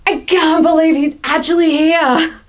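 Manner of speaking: hammed-up wonder; excited disbelief. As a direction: act out a thrilled inner voice. Acting Thomas's inner reaction.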